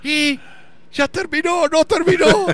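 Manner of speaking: suffering fan shouting from the balcony; anxious yell. Retold or imitated. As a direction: yell out as the match nears its end. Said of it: "sale y grita".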